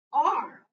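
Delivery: "R" said loud and drawn out as "ar"; slow, emphatic "R" sound; letter sound demo. Shown as a demonstration. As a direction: model a long, open "ar" for R. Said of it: "it will be read".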